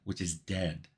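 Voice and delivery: ordinary delivery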